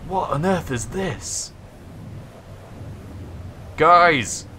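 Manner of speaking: high, loud panicked yell; scared shouting. Real, not acted. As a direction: scream for help in terror. Fleeing storm, calls out.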